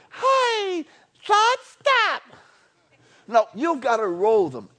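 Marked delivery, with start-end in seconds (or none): 0.1-2.2 much higher, pleading voice; mock plea. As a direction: squeak it out like a feeble protest. Telling a worried thought off.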